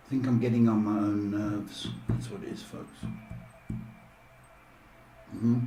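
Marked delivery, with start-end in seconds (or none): none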